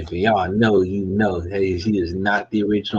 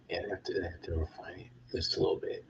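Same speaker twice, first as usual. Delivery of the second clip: soft whispered aside to himself; 13 dB quieter, faster than usual. Real, not acted. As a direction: whisper it under your breath.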